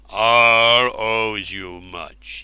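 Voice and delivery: slow, grand royal voice; royal king voice. Acted. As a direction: speak slowly like a king. Ubar addresses hero.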